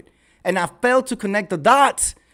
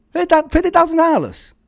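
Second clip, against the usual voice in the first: higher and louder, stressing thousand; incredulous emphasis; 8 semitones higher than usual. Real, not acted. Exposing a tiny nonprofit budget, incredulous. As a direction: blurt it out in disbelief. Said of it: "repeated louder".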